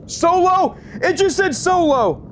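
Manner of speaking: high, excited exclamation; amazed outburst. Real, not acted. Ghost hunter hearing the box say solo, amazed outburst. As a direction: cry out in real astonishment.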